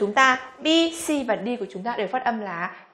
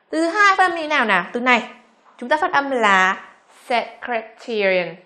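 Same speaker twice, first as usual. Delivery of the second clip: usual speaking voice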